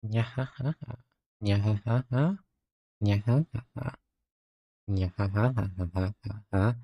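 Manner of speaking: soft hammed-up nyahaha cackle; mock villain cackle. Playful villain laugh.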